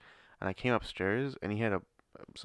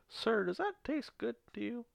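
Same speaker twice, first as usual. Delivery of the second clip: put-on high, sweet old lady voice; 14 semitones higher, 5 dB quieter than usual. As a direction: mimic a little old lady. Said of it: "this old lady comes up to me".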